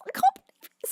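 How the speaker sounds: high, bursting squeal of disbelief; can't contain the joy; overwhelmed disbelief. Real, not acted. Streamer marvels that this is her job, squealing.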